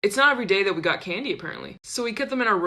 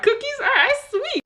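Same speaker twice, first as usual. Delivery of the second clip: squeaky excitement; 5 dB louder than usual. Retold or imitated. Reacting to cookie offer.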